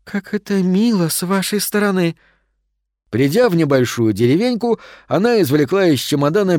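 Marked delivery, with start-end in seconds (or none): 0.0-2.1 high, put-on old lady exclamation; exclaiming. A narrator's reading. Old lady thanking the shadows, exclaiming in a high voice. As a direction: exclaim it in a raised, delicate voice. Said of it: "воскликнула госпожа Офелия".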